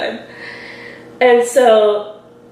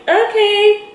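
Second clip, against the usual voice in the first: high-pitched, uneasy okay; a mock-polite, very high okay; awkward okay; 11 semitones higher than usual. Babysitter humoring a chatty admirer, awkward high okay. Awkward reply to crush's small talk.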